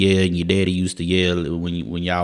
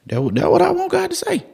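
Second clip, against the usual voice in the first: very high, squealed excitement; overjoyed. Real, not acted. Preacher longing to hear God's praise, overjoyed squeal. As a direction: voice shoots into falsetto with joy.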